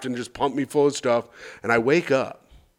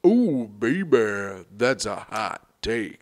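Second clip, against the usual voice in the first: sultry tease; 5 semitones lower, slower than usual. Acted. Teasing cohost.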